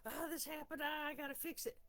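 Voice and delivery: imitating her own anxious thoughts; mock panic. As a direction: act out the fluster of fixing a glitch. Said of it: "I can have anxiety because".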